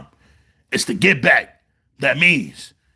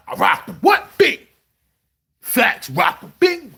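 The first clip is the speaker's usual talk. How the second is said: usual speaking voice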